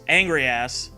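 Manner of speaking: ordinary delivery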